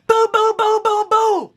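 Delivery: loud, high boom boom knocking sounds; mock knocking on a door at midnight; door pounding. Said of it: "I'm like".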